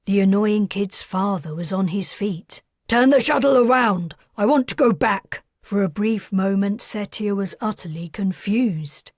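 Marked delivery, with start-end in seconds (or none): 2.9-5.4 loud, fast, higher angry order; demanding to go back; frantic demand. Acted. Father demands turning back.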